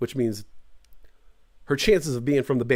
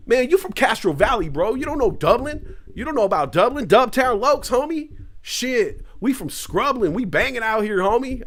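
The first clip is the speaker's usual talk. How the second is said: hammed-up hard street talk, higher; tough Bay gangster voice; 7 semitones higher than usual. Mock gangster boasting to friend. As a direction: puff up like a tough homie from the block. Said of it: "I don't know where that came from, bro".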